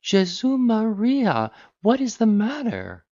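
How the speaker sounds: very high, slow startled cry; surprise and affright. A narrator's reading. Daughter pretending to wake, alarmed by father at door. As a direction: feign waking in sudden fright. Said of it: "exclaimed in a tone of surprise and affright".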